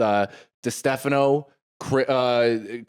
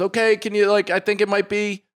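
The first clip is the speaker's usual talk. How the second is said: higher, tentative wife's voice; nervous wife; 8 semitones higher, faster than usual. Retold or imitated. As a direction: ask him to check, uneasy and higher. Wife's nervous request, high.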